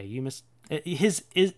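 ordinary delivery

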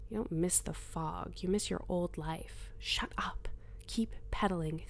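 hushed inner voice, close to the ear; a voice inside her head, whispering; whispered inner voice. Acted. Inner voice answers narrator.

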